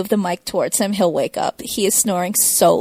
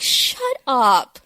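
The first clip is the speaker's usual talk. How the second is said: builds higher and louder to a shout; exasperated shut up; 8 semitones higher, 7 dB louder than usual. Retold or imitated. Fan yelling at the smug writer, rising exasperated shout. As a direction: start grumbling, end shouting it down.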